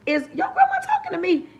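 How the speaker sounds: put-on a kid's high, hushed shock that grandma is addressing them; stunned disbelief. Kid asks friend in disbelief, high hushed voice. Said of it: "You looking at your friend like".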